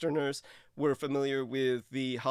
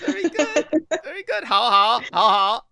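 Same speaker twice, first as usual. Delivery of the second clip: delighted praise; 11 semitones higher, 11 dB louder than usual. Real, not acted. Praising the guest's pronunciation.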